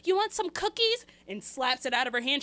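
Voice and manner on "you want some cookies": high sing-song offer; mom coaxing. Retold or imitated. Mother bribing the toddler with cookies, high and sweet. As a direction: sweeten the voice to bribe a child. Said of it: "mom offering cookies".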